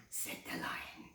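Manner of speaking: usual speaking voice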